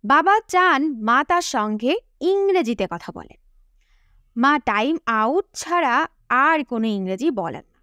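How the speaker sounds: ordinary talk, nothing marked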